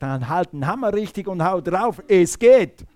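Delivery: father's firm instruction, building to a loud finish; insistent father. Retold or imitated. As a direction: coach a child forcefully, ending on a shout. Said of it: "Dann hat er gesagt".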